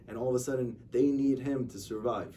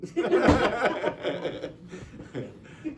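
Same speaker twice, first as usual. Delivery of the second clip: big, high burst of laughter; helpless high laughing fit; real laughter; 8 semitones higher, 6 dB louder than usual. Real, not acted. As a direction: crack up loudly at your own punchline. Laughing at own punchline.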